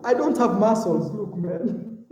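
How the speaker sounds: usual speaking voice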